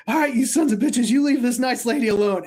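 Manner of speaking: loud, high cop bravado, hammed up; mock heroic yell. Retelling how the officer yelled at gremlins in the washing machine, a loud mock heroic shout. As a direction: bellow it like a cop defending a lady.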